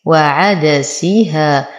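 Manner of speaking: وعدسيها slow, each short syllable evenly sounded; measured وعدسيها, nothing snatched or rushed; every vowel given full time. Shown as a demonstration. Teacher showing the correct reading, slow even syllables in وعدسيها. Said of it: "وأبتعد عن الاختلاس".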